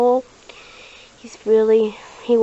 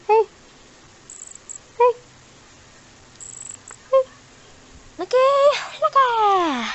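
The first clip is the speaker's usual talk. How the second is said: ordinary talk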